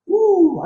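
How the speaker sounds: loud, high "woo!"; excited whoop. Real, not acted. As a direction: let out a big high whoop. Exclaims before resuming the teaching.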